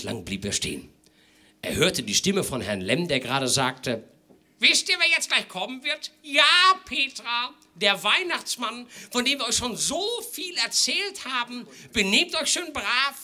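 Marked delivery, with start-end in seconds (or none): 4.6-13.2 put-on very high, sweet talk to children; a father coaxing little kids; sing-song parent voice.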